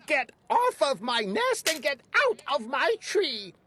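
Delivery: high, scolding bird voice; storybook bird scolding the elephant; indignant bird. A narrator's reading. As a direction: scold him off the nest. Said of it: "she sputtered".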